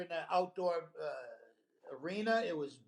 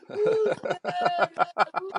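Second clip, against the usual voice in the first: very high, loud whooping cheer; whooping hecklers; 12 semitones higher, 10 dB louder than usual. Retold or imitated. Rowdy southern crowd whooping at the comic, high loud cheer. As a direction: whoop it like a drunk fan in the stands. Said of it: "these guys start yelling at him".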